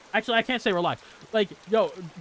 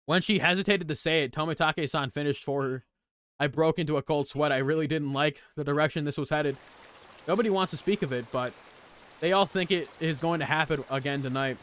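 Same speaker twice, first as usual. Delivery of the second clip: lower, steady read-aloud voice; visual novel reading; 6 semitones lower, 4 dB quieter than usual. Acted. Streamer reading visual novel lines aloud, low and even. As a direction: read the game's text calmly, a touch lower.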